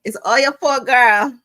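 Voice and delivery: loud, high, teasing accusation; high-pitched, exuberant teasing; playful blame. Real, not acted. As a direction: belt it out in mock blame. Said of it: "I love saying that".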